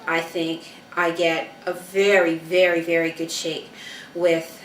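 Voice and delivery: normal talking voice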